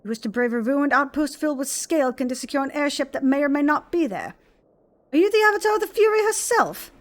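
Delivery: a hint of a grave noble questioning strangers; lightly voiced stern lord. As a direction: read it like a proud lord granting an audience. Reads NPC dialogue aloud.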